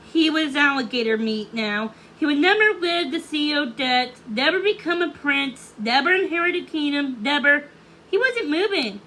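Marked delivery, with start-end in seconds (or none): none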